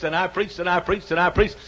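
usual speaking voice

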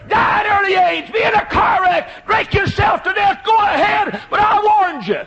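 high, loud fiery pulpit shouting; preaching at full force. Acted. Preacher warning the young people in the pews, fiery shouting. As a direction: thunder the warning at the whole church.

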